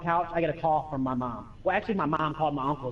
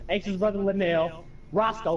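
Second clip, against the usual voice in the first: ordinary talk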